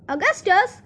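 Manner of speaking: storybook father's urgent call; crying out. A narrator's reading. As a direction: cry the name out high. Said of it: "cried Mister Gloop".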